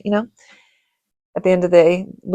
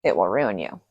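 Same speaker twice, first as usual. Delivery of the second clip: grave warning; 10 semitones lower, 7 dB quieter than usual. Retold or imitated. Grandma warning the grandchild off babysitting, low and grave. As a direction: warn it like a curse. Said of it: "because she's like".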